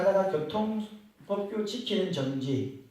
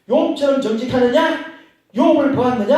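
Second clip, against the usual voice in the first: louder, pressing question about Job; loud rhetorical question; 7 semitones higher, 9 dB louder than usual. Retold or imitated. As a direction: press the listeners hard with the question. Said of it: "하고 이래 말하는 겁니다". (크게 다그치듯 묻는 목소리)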